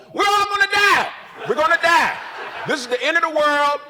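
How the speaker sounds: a shouted mock end-of-the-world panic. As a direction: yell it like the world is ending, for laughs. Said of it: "take a photo and go".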